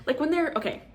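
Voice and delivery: breaking into a high laugh. Real, not acted. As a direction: laugh it out, can't keep going. Laughing at herself.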